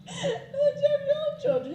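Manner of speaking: high, laughing through the words; can barely get it out for laughing. Real, not acted. Teller laughing as she repeats the villagers' line.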